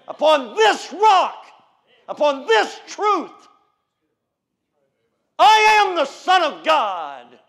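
loud, slow, building pulpit cry; loud preacher's cadence; preaching. Acted. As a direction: thunder it from the pulpit. Preaching at full force.

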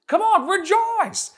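high, loud call to rejoice; rallying shout. Real, not acted. Preacher calling the church to rejoice aloud. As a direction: lift the congregation with a shout. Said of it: "say with me".

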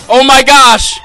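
sudden high shriek of fright; jolted by a scare, cry out; startled scream. Real, not acted. Gamer startled by a monster, shrieking. Jump scare in horror game.

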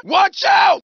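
high, loud sudden yell; warning shout. Acted. Passenger warning the driver of danger, sudden shout.

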